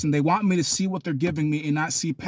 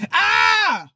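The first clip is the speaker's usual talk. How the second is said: loud, high scream; yelling; 7 dB louder than usual. Acted. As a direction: scream wordlessly at the carer. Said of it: "if you yell back".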